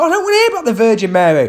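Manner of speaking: fast, high exasperated heckler; the heckler's fast, high complaint; mock heckler whine. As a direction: rattle it off like a fed-up drunk. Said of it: "He's like".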